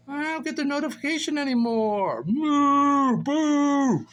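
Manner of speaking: high whine building to loud boos; mock pouting. Whining over lost notifications, high mock boo. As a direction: complain up high, then boo long and loud.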